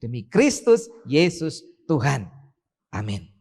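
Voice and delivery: ordinary talk, nothing marked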